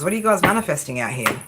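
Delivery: ordinary talk, nothing marked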